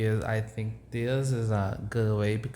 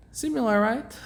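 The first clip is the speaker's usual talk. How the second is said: ordinary talk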